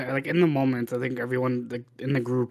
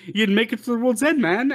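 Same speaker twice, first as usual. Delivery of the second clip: excited; 8 semitones higher than usual. Retold or imitated. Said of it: "some guy's like".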